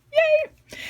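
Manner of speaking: squealed high cheer; excited yay. Real, not acted. Cheering end of craft video.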